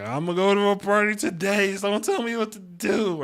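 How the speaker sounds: high, loud mock sob of a whiny partygoer; hammed-up fake crying, pitched high and loud. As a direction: whine like a pretend crybaby. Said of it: "Why am I fake crying?".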